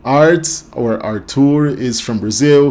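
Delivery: normal talking voice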